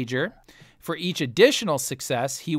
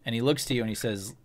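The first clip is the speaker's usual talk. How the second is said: usual speaking voice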